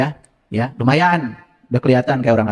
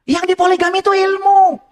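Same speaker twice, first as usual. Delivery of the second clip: emphatic correction; 13 semitones higher than usual. Real, not acted. Punchline correction.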